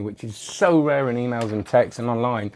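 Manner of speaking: ordinary talk, nothing marked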